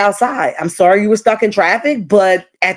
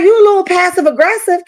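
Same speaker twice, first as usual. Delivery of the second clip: mock accusation, pitched up high; imitating Britt, sassy; 10 semitones higher than usual. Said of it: "She like".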